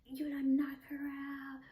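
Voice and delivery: high, loud jeering hoots; mocking friends. Friends laughing at her on the ground, imitated high mocking jeers. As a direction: imitate friends hooting in mockery. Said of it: "his friends just laughing at me like".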